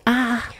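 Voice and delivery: high imitated turtle moan; turtle noise. Shown as a demonstration. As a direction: do the turtle sound. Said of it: "They go like this".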